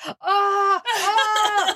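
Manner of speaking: high, loud mock moans of pain; hammed-up "aah" as if being hurt; fake agony cries. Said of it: "where you, like, fake".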